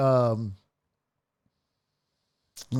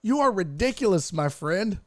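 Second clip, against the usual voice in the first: ordinary talk, nothing marked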